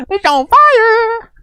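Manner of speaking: gasp, then a very slow, high, drawn-out cry; hammed-up horror at something burning; mock alarm. Mock panic at flaming souvenir.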